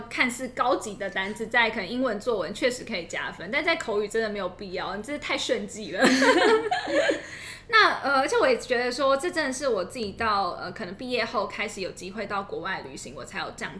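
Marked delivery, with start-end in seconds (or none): 6.1-7.7 high laugh. Real, not acted. Laughing at own joke.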